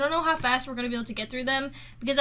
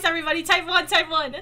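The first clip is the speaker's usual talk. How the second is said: ordinary talk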